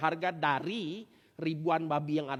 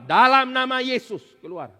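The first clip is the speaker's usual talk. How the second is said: loud, slow, higher command; commanding exorcism; 6 semitones higher, 12 dB louder than usual. Pretend. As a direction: cast the demon out in Jesus' name. Commanding a demon out. (perintah keras dan lambat)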